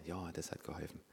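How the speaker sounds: soft, small voice, playing sheepish; meek quiet answer. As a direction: answer softly like a caught child. Meek replies to the Holy Spirit.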